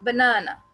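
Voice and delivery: Banana with short ब and loud, long middle ना; stress on the middle syllable. Shown as a demonstration. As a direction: punch the second syllable ना in banana. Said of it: "बीच वाले ना को हमने stress दिया".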